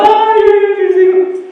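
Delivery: high, wailing lament, acted; wailing neighbors. Neighbors wail over sons taken by soldiers, high mock lament. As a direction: cry out like a grieving parent. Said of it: "Y los vecinos llorando".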